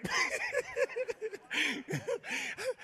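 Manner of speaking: high, quiet laugh; laughter. Real, not acted. Preacher tickled after teasing the congregation, high laughing. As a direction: break up in a long high-pitched giggle.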